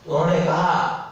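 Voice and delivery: ordinary delivery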